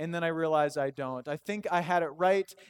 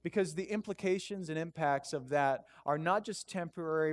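normal talking voice

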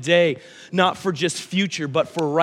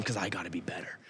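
whispered boast; 9 semitones lower, 14 dB quieter, faster than usual. Retold or imitated. Son's boasting quote, retold.

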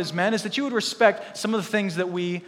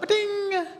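text alert sound; 13 semitones higher than usual. Retold or imitated. Phone text alert imitated.

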